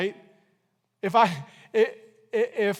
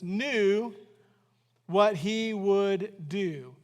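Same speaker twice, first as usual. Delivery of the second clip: slow, measured Bible reading; reading the verse aloud to the church, slow and measured; scripture reading; much slower than usual. Acted. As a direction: read the verse slowly and deliberately.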